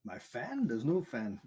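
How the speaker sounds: high, loud surprised protest; indignant my fan. Real, not acted. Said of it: "now I can hear your fan".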